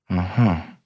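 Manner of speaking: a slow, pondering uh-huh; slow thoughtfulness. A narrator's reading. Policeman weighing the suspect's answers, slowly thoughtful. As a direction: mull it over as you answer. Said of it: "with slow thoughtfulness".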